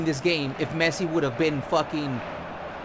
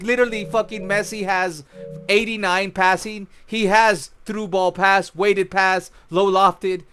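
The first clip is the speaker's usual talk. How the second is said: ordinary delivery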